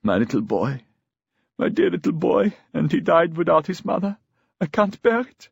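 high, acted wail of grief; tearful lament climbing in pitch; sobbing. A narrator's reading. Mother learning her son has died, sobbing. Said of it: "Ada began to sob".

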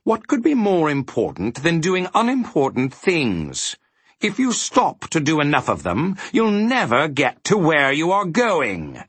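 high, swooping villain voice; scheming villain, pitch riding up; villainous. A narrator's reading. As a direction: gloat with theatrical relish. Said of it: "with a villainous laugh".

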